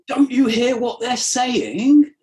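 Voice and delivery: high, loud, slow outraged protest; hammed-up indignant religious leaders; freaking out Pharisees. Pharisees scolding Jesus in outrage, slow and shrill-high. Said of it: "the Pharisees freak out".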